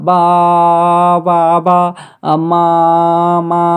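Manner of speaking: slow, stretched syllables, lightly imitating a babbling baby; imitated baby babbling. Teacher imitating a child's babbling stage, lightly babbled. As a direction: draw out each বা and মা like babble. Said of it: "আমি বাবলিং স্তরে বলেছি".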